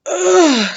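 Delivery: loud, high retching ugh; disgusted sputter. A narrator's reading. Sophie sputters at the foul taste, a loud ugh. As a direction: gag on the taste. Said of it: "she sputtered".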